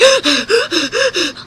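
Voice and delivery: startled gasp. Retold or imitated. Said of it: "she's like".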